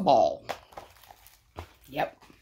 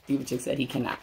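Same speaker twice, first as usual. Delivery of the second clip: ordinary delivery, nothing marked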